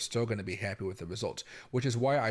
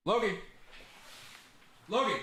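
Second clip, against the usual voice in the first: calling the dog; 10 semitones higher than usual. Real, not acted. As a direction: call the dog sharply to come back.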